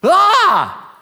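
loud, high scream of terror, hammed-up; sudden high yell of panic; mock freak-out scream. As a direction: scream as if an angel just appeared. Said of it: "They freak out".

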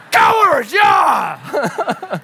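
shouted, high and loud; a real whoop of delight; excited shout. Real, not acted. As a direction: cheer the answer out. Cheering a right answer.

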